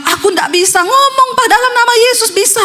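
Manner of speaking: high, strained outcry; acted desperate protest. Possessed husband tells wife he cannot say it, high desperate protest. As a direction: plead it high, as if unable.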